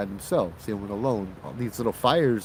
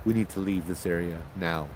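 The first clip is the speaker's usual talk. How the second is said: shaken, low; 5 semitones lower, 5 dB quieter than usual. Retold or imitated. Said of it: "just completely pale".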